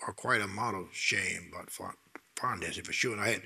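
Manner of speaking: low, soft confession whisper. Real, not acted. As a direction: admit it under breath. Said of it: "confessional whisper".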